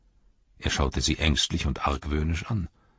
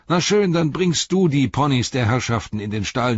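high, loud instruction to a servant; bustling innkeeper giving commands; calling out orders; 8 semitones higher, 5 dB louder than usual. A narrator's reading. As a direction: order the helper off briskly. Innkeeper orders his servant about.

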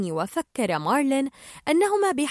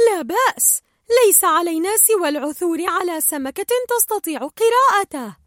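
cheerful Dory; 7 semitones higher, 5 dB louder than usual. Acted. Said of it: "أمسكت دوري بالقناع وقالت".

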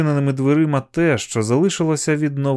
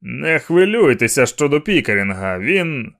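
moderately raised call that breaks off; mild shout; 2 semitones higher, 3 dB louder than usual. A narrator's reading. Man shouting back to reassure police, raised voice. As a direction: call back, then stop short. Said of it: "і крикнув у відповідь".

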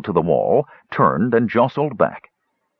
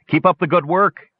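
shouting; 10 semitones higher than usual. Acted. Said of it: "shouted Flint".

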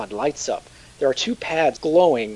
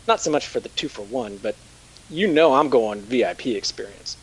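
ordinary delivery

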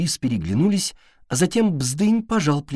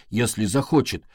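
ordinary delivery

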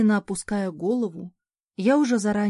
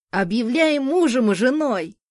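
higher, louder cheerful shout; 4 semitones higher, 6 dB louder than usual. A narrator's reading. Boys cheering the couple with a loud shout. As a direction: yell it out gleefully. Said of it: "выкрикнули мальчишки".

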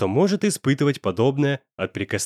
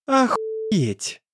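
mocking; 9 semitones higher than usual. A narrator's reading. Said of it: "Насмешливо отозвался бандит".

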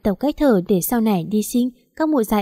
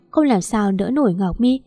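usual speaking voice

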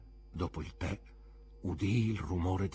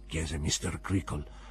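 usual speaking voice

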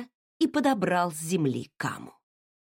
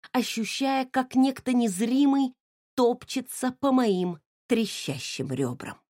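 ordinary delivery, nothing marked

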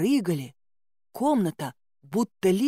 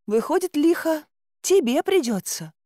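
mock sympathy; 9 semitones higher than usual. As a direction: fake pity, pitched high. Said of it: "с наигранным сочувствием".

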